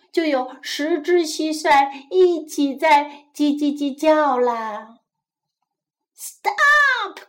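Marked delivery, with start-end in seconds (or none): 6.2-7.2 high, loud outcry; crying out. A narrator's reading. Mouse cries to the noisy crickets, crying out. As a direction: cry it out, pitch leaping up. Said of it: "cried the mouse".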